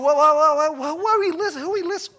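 loud, high, startled whoa-whoa; a big loud double-take of alarm; alarmed outcry. Retold or imitated. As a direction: stop the room in shock at what you're hearing. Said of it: "And I'm like".

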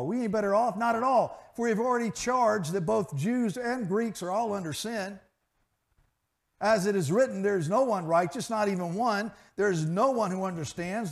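lifted pulpit voice, ringing; preaching scripture. Acted. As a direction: proclaim it to the congregation. Scripture read with preaching force.

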